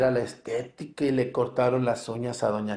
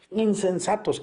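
exclamation read out; 8 semitones higher than usual. Shown as a demonstration. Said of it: "Aquí vienen los signos de admiración".

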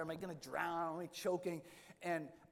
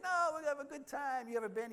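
higher, louder cheery reassurance; breezy Father Mike; 10 semitones higher, 5 dB louder than usual. Retold or imitated. Father Mike brushing off the worried priest, cheery and higher. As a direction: sell the trip with easy enthusiasm. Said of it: "And Father Mike's like".

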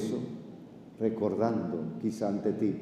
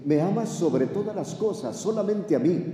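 pleading question; 9 semitones higher, 4 dB louder than usual. Acted. Jesus pressing the question.

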